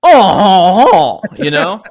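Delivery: slow, drawn-out "pecan pie" with a hammy chuckle; French villain voice. Acted. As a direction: ham it up like an old stage villain. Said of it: "Are you French now?".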